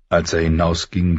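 usual speaking voice

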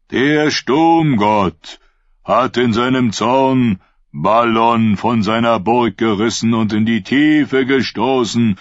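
preaching prophet. Acted. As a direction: proclaim it like a fervent prophet. Prophet preaching to his flock. (Prophet predigt laut und langsam zu seiner Schule)

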